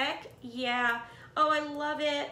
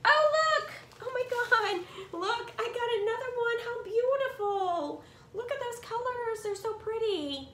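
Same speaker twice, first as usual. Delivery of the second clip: excited squeal; 10 semitones higher than usual. Real, not acted. Opening another gift, squealing.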